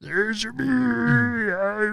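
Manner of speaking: very high cry, each word hugely drawn out; put-on enthusiasm. Showing co-host enthusiasm from the show's opening, hammed-up drawn-out cheer. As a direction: hold it long and high in over-the-top excitement. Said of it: "Let's have some enthusiasm".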